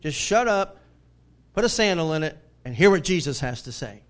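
loud, forceful rebuke; stern God. Acted. God telling Peter to stop talking, stern loud rebuke. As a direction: lay down the order to a rambler. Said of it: "What's God saying?".

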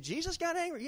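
high-pitched mock disbelief; incredulous. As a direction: echo it back as an astonished objector. Voicing astonished listeners' disbelief.

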